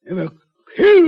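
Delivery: sudden high astonished cry on killed; shocked disbelief. Acted. Doctor stunned by the girl's claim, shocked cry. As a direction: blurt it out in disbelief.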